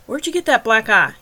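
snapped question. Acted. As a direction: snap it at him. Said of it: "he snapped".

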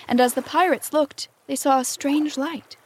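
ordinary delivery

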